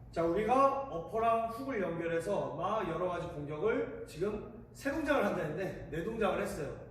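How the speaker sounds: ordinary talk, nothing marked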